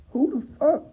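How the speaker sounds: very high mock-puzzled voice; baffled disbelief. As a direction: squeak it out, acting totally baffled. Acting woman not recognizing the man.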